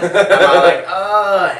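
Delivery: hammed-up loud groan of pain; a laughing mock groan as things rain down. Kid hit by falling bottles, laughing mock groan.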